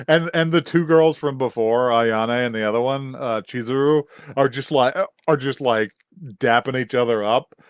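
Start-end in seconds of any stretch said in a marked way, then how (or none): none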